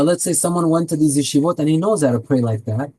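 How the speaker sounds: ordinary talk, nothing marked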